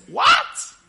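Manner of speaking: high loud astonished cry; shocked children. Retold or imitated. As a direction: gasp it out in disbelief. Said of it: "they say".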